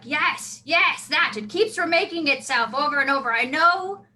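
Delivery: excited confirmation. Acted. Dwarf telling a listener she has got it, excited.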